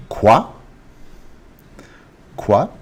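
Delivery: quoi said as "kwah", rising at the end; French pronunciation demo. Shown as a demonstration. Teacher showing how French "what" is pronounced, quoi as "kwah". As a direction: model quoi as "kwah" for learners. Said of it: "What is pronounced".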